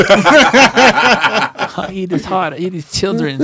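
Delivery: high, drawn-out boxer boast; Mike Tyson. Acted. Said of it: "Mike Tyson doing his own voice is hilarious".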